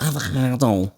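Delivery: throaty Scouse K in avocado; hammed-up Scouse avocado; Scouse accent. Shown as a demonstration. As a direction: say avocado like a thick Liverpudlian. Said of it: "Is that a good accent?".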